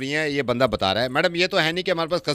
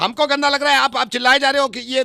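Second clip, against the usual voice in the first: mock indignant; 10 semitones higher, 4 dB louder than usual. Arguing with neighbour on phone.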